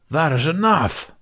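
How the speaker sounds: sharp, loud command to stop a duel; commanding shout. A narrator's reading. Saber Master stopping the duel, commanding shout. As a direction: bark it out to halt the fight. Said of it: "the Saber Master commanded".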